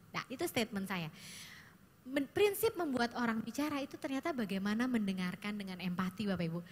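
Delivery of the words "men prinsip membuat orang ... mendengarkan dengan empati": normal talking voice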